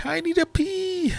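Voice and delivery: squeaky high voice. Pretend. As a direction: squeak it like a cartoon. Joking about needing a pee.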